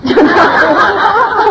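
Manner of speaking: high, loud, real laughter. Real, not acted. Mother laughs out loud while telling the church group about her son, high. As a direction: crack up at the memory.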